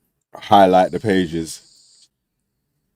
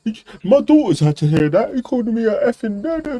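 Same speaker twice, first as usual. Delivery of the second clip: high, whiny mock politician voice; hammed-up indignant whimper; mock Sunak whine. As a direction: imitate a wounded politician whining about an insult. Mocking Sunak's complaint.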